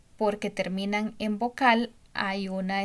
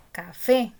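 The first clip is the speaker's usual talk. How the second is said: "Café" with a louder, rising "fé"; stress on last syllable; 7 dB louder than usual. Shown as a demonstration. Teacher proves the accent is last, hitting "fé" in "Café". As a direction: punch the final "fé" of "Café". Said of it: "el acento está en la última sílaba".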